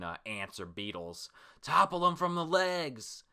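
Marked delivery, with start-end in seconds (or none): 1.6-3.2 high, loud, drawn-out command; hammed-up samurai shout; battle call. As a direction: holler orders to fellow fighters. Acted samurai calling out in battle.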